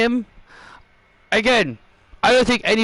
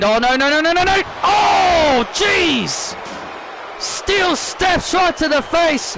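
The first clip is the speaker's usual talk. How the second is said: excited shouting commentator; 10 semitones higher than usual. Acted. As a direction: call the ambush like live TV. Commentator reacting to attack.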